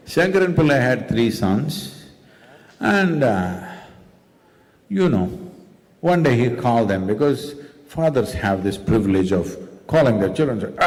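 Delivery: normal talking voice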